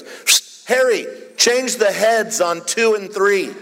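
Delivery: a psst, then call out slowly and high; funeral director calling out. Retold or imitated. Funeral director to his helper Harry, high drawn-out call. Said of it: "And he goes".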